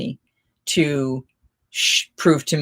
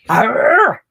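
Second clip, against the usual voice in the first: loud, high "arr" growl; playful growly cry; 7 semitones higher, 7 dB louder than usual. Pretend. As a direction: let out a mock "arr". Playful cry between stories.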